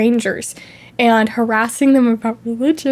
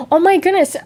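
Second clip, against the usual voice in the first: normal talking voice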